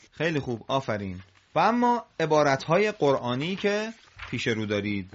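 ordinary talk, nothing marked

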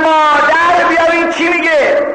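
high, sustained yell for mother; full acted shout. Pure girl calling her mother for help, full shout. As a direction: shout it high all the way through. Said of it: "داد زد".

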